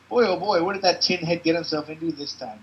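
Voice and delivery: brash joking sidekick; Larry voice. Acted. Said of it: "says Larry".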